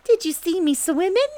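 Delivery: high, loud joey squeal; thrilled little child voice; excited squeak. A narrator's reading. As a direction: squeak it, bursting to show off. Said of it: "squeaked Roo excitedly".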